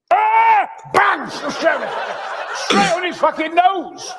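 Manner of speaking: high, loud victory roar; triumphant yell. Acted. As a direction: cheer the punch landing. Acted fight, triumphant yell.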